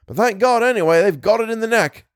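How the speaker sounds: high, exultant shout; yelling back. A narrator's reading. Tom to Bill as the enemy falls, yelling. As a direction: keep yelling, pitch held high throughout. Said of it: "Tom yelled back".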